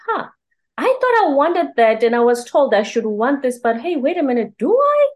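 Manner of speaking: rising, wondering inner voice; surprised self-questioning. Retold or imitated. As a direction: catch yourself doubting old wants. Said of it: "I was like".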